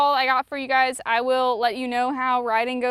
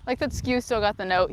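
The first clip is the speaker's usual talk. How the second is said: ordinary talk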